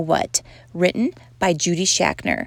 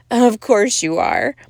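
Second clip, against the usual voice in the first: slow, louder mocking fox; snickering; 5 dB louder than usual. A narrator's reading. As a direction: draw it out with a sly snicker. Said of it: "snickered the fox".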